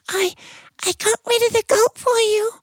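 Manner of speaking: a tiny ant calling from the rose bush. Acted. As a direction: miniature squeak. Ant reports success.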